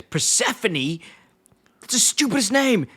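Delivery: high-pitched imitation of a disgusted boss; mock scorn. Herb retold scorning the car name.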